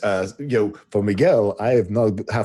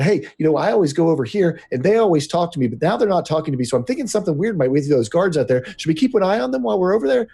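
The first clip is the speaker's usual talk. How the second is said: fast, higher chatter that never pauses; talking fast and pitched higher, words tumbling out; fast-talking character; 8 semitones higher, faster than usual. Retold or imitated. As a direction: rattle it off like a nervous guard-watcher. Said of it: "just spoke really fast and all the time".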